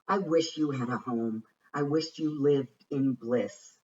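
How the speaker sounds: ordinary delivery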